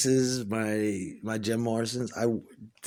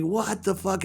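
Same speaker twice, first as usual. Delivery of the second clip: baffled; 8 semitones higher than usual. Retold or imitated. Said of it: "And I'm like".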